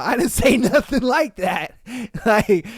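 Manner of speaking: usual speaking voice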